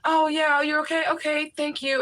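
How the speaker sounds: high-pitched, solicitous store helper; eager helper. Acted. Grocery store man helping a charming customer, eager high voice. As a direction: voice an over-helpful man, pitched well up.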